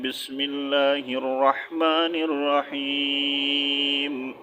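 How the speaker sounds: slow, drawn-out Quranic recitation; measured Quran-style recitation. Acted. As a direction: recite it reverently and unhurried. Opens lesson with recitation.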